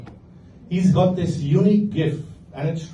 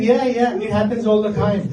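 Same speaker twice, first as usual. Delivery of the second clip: higher, casually unbothered yeah yeah; breezy uncle; 6 semitones higher, 3 dB louder than usual. Retold or imitated. Uncle reassures nephew during massage, lightly higher and breezy. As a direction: brush it off like it's nothing.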